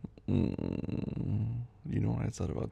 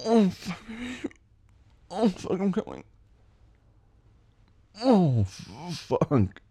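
climax; 9 semitones higher, 5 dB louder, much slower than usual. Acted. Climax in an intimate scene.

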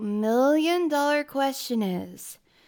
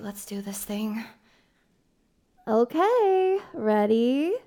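ordinary delivery

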